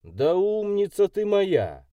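high, drawn-out mock praise; snide praise. A narrator's reading. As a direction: praise her slowly with a sly edge. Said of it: "похвалил я ехидно".

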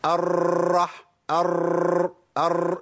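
"الرح-، أر-" cut off on a forceful, long rolled r; violent trilled ra. Shown as a demonstration. Teacher shows students the mistake, ra forced and trilled. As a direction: attack the ra in "أر-" and let it rattle. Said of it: "بيخش على المخرج بعنف".

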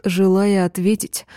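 usual speaking voice

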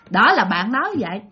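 ordinary delivery, nothing marked

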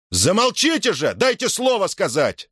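high, angry demand for silence; infuriated. A narrator's reading. Timofei tries to silence the heckling crowd, infuriated. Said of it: "разъярился Тимофей".

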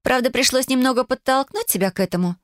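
put-on a cheerful young cousin agreeing; young girl voice. A narrator's reading. Cousin figure voiced higher.

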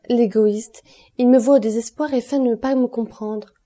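exasperated, hurt outburst; wounded reproach. A narrator's reading. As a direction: burst out at a lover who won't understand. Wounded heroine reproaches her lover.

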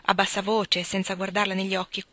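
usual speaking voice

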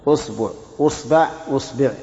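أصبع said usbu, usba, usbi; أصبع with initial u, three variants; damma on hamza. Shown as a demonstration. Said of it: "ضم الهمزة".

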